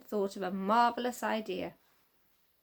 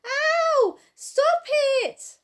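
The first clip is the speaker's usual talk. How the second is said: shouting in pain; 10 dB louder, much slower than usual. A narrator's reading. Man attacked, shouting for help.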